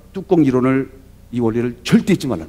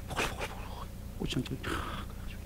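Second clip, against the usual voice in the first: soft secret whisper about the stew; 20 dB quieter than usual. Retold or imitated. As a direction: whisper it as if hiding a surprise. Wife secretly cooking a surprise. (비밀스럽게 속삭이듯)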